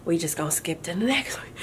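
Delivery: hushed aside, sneaking past it; embarrassed whispered aside. Retold or imitated. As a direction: whisper it so nobody notices you skipping. Retold aside skipping unknown word.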